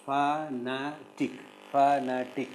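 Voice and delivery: slow "fanatic" in three separate syllables; "fanatic" with each syllable set apart. Shown as a demonstration. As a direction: break "fanatic" into fa, na, tic. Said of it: "Can you syllabify these words?".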